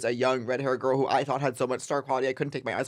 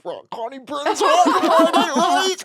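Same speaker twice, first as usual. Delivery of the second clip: high squeaky bro mockery; 9 dB louder than usual. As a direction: pitch way up, loud and laughing. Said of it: "They were like".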